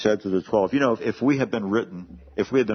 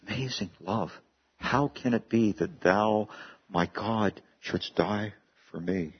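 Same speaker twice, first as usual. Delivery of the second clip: hymn recitation; 4 dB quieter than usual. Acted. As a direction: read the hymn lines quietly, in awe. Recites Wesley hymn text.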